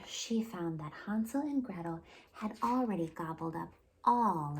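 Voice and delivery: put-on lively, expressive read-aloud for little kids; storytime narration. A narrator's reading. Children's librarian reading a fairy tale to kids, lively storytelling.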